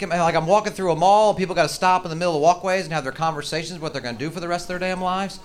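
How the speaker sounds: ordinary talk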